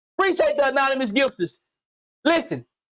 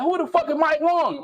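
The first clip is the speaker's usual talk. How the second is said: mock confused shout; 7 semitones higher than usual. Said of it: "He probably like".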